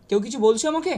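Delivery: ordinary talk, nothing marked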